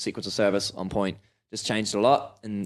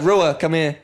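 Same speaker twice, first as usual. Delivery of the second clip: calling out; 8 semitones higher, 10 dB louder, slower than usual. Retold or imitated. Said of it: "I was like, I was like".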